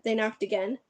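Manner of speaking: plain reading voice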